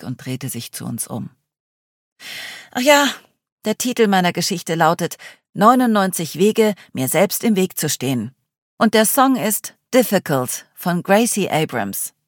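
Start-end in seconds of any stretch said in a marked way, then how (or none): 2.7-12.1 put-on lively young woman telling a sad tale; dramatic self-mocking summary; Charlie voice.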